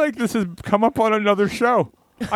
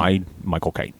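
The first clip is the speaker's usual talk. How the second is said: Michael Caine; 12 semitones lower, 4 dB quieter than usual. Acted. Introducing himself as the actor, English accent. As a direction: do a deep English film-star introduction. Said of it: "Michael Caine's on board".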